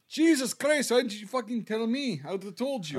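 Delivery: joking Latvian listener voice that comes out Irish; mock Latvian listener. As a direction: play an exasperated Latvian listener, accent landing Irish. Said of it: "they're gonna be listening to this going".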